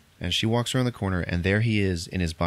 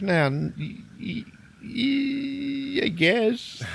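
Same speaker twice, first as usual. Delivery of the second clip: high, hesitant mock stammer; nervous stammer; much slower than usual. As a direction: stall on the sounds, squeaking out an answer. Cornered captive stammers an answer.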